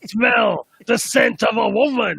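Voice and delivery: loud gung-ho soldier shout; Al Pacino. Retold or imitated. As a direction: bark it out like a blind old colonel.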